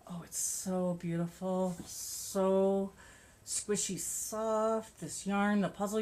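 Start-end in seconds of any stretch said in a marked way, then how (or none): 0.1-1.7 admiring whisper. Real, not acted. Admiring the soft yarn. 1.8-5.0 drawn-out gush. Real, not acted. Admiring the soft yarn.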